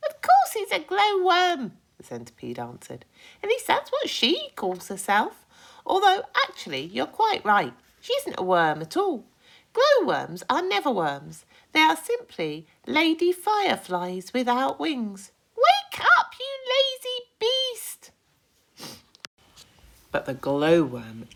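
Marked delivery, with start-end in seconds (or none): 0.1-15.3 high, loud fussy creature voice; a bossy high-pitched centipede nagging. Acted. Centipede fussing at James over his boots, high and loud. 15.5-18.1 high, loud exasperated centipede; angry yell. Acted. Centipede yelling at the sleeping glowworm, angry.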